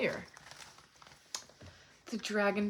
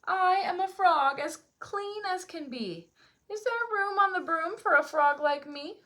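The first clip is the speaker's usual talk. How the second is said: a light frog voice, higher; croaky frog; 7 semitones higher than usual. A narrator's reading. Frog asking the witch for room, lightly voiced. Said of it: "said with a croak".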